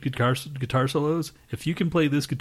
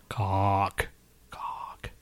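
weird creepy cock. Pretend. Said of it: "I don't know why I said it like that".

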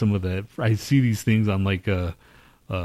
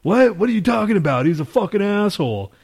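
mock outrage; 9 semitones higher than usual. Mocking critics who scoff at a dead president, high and indignant. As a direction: play a scornful critic in a high pitch. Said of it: "they're gonna be like".

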